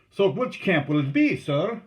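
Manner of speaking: loud, high question across water; calling out. A narrator's reading. Lapetite asks the leader about camp, calling out. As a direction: hail the other canoe. Canoeman calls to another boat.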